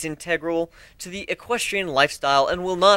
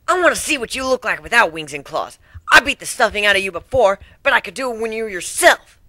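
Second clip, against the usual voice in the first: cocky pony voice, a bit higher; bragging; 5 semitones higher, 3 dB louder than usual. A narrator's reading. Dash brags to Griffin, lightly voiced cocky tomboy. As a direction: show off how tough she is. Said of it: "Dash bragged".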